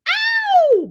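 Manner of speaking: sudden high yelp; excited whoop. Real, not acted. Whooping after singing along, high and sudden. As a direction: let out a high pop-star whoop.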